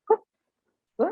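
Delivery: p and b said apart, clearly; demo sounds p and b. Shown as a demonstration. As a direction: show p then b clearly. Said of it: "these four sounds are".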